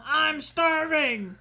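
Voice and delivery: ordinary talk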